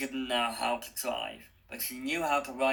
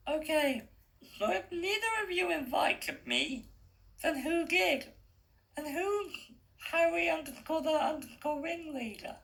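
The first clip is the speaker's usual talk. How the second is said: put-on much higher, unsure girl's voice; young girl Stella. A narrator's reading. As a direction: sound like the hesitant heroine.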